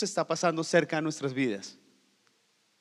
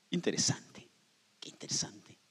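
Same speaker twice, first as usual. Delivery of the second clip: whispered, half to oneself; a hushed, thoughtful whisper; whispered musing; 3 semitones lower, 7 dB quieter than usual. Pretend. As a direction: breathe it out pensively. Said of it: "O quizá diríamos".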